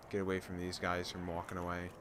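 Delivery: ordinary delivery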